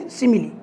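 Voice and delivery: teacher's answer, सिमिली with a final '-lee'; preferred pronunciation. Shown as a demonstration. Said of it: "क्या बोलेंगे?". (सिमिली का 'सि-मि-ली' उच्चारण)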